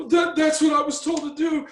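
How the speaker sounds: high, strained mock excuse; nervous sergeant. Man voicing the shaking sergeant's excuse, high and strained. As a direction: play the sergeant squirming out of blame.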